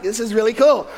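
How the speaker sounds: mock-mellow, low-key approval. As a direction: imitate someone sitting placidly through a thriller.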